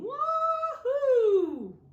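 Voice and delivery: very high, loud gleeful yell; yelled wahoo. Acted. Snowmen sledding down the hill, shouting with joy. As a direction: shout it like a snowman flying downhill. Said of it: "they're saying".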